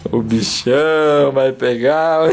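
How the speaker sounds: slow, drawn-out mock monster threat; playful monster. Father playing the big monster to tease his baby, slow mock threat. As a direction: creep up on him like a play monster.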